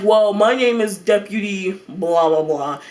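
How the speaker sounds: lightly voiced deputy introducing himself, drawn out. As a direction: imitate an officer's slow introduction. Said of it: "and he's like".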